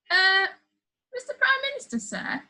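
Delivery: put-on high, hesitant small boy voice; timid boy. A narrator's reading. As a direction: ask it nervously, shrinking back. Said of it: "Joe asked timidly".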